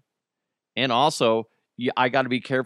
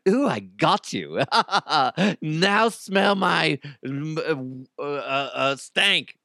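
high, slow, cheeky cartoon duck; farting duck voice; 3 semitones higher, much slower than usual. The rubber duck teasing after being squeezed, cheeky high duck voice. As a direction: drag it out like a smug duck that just let one go. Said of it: "sounds like he's farting".

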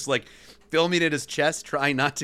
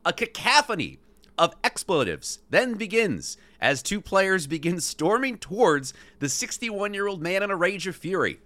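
ordinary delivery, nothing marked

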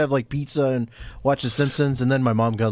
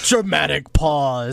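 mock-dramatic; much slower than usual. Announcing the pause theatrically.

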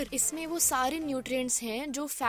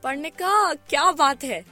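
excited; 3 semitones higher, 9 dB louder, much faster than usual. Acted. Celebrating the finished dish.

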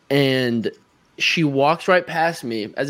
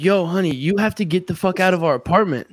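mildly urgent, pitched-up order; retold urgent plea; 5 semitones higher than usual. Man telling stranger to leave his apartment, urgent higher voice. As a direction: re-enact telling someone to get out, voice lifted.

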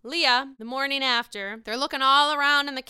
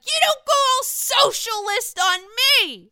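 higher, louder mock drunken retort; drunk Sonia yelling back; 12 semitones higher, 9 dB louder than usual. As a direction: fire it right back at her, even louder. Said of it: "she's like".

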